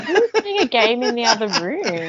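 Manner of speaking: laughing through a puzzled question; half-laughed mock confusion. Listener laughing as she asks who is gaming. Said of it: "and I was like".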